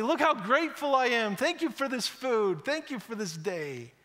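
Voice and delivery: mock pious gratitude. Mock showy prayer.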